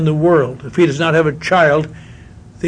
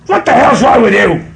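gruff barfly yelling, higher and louder; a drunk stranger in a bar confronts him, louder; bar guy; 5 semitones higher, 5 dB louder than usual. Retold or imitated. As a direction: bark it like a rude guy in a bar. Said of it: "some guy comes over and says".

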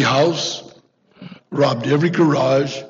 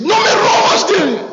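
put-on high, loud shriek of a frightened old woman; panicked old lady; 4 dB louder than usual. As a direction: shriek at the burglar, much higher. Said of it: "she goes".

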